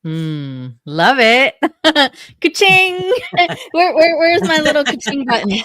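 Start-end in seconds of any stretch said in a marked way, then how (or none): 1.6-2.4 high, loud burst of laughter; bright laugh. Real, not acted. Host laughs at millionaire promise. 2.4-2.9 high, loud cash register imitation; mock ka-ching. Host mimics cash register. 3.0-3.7 high falling laugh; giggle. Real, not acted. Host laughs. 3.7-5.6 high, fast, laughing search; playful excitement. Real, not acted. Host playfully hunts for button.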